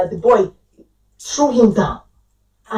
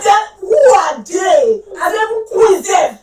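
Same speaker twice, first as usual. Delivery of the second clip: raised, high, indignant demand; a hammed-up furious mum; mock outraged yell; 6 semitones higher, 4 dB louder than usual. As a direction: challenge them like an angry mother. Mother confronting school, raised voice.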